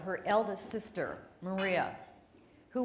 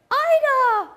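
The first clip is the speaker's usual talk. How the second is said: loud, high call of "Ida!"; 15 dB louder than usual. Retold or imitated. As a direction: shout her name across the yard, much higher and louder. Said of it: "And he's like".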